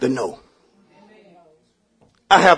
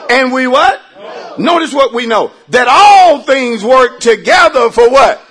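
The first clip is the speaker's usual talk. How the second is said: preaching at full force; 3 semitones higher, 5 dB louder than usual. Acted. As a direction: call out to the congregation. Call and response, shouting.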